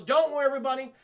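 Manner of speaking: loud, high hammed-up showman; grand villain. Mysterio reassuring the crowd, loud hammed-up showman. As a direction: boom it out like a stage villain.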